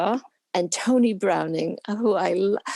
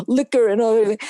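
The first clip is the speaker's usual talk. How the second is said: louder, emphatic mock outrage of a father; disapproving father; 3 semitones higher, 4 dB louder than usual. Father forbidding daughter to go to film school, mock outrage. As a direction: lay down the law as a scandalised parent. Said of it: "My father said".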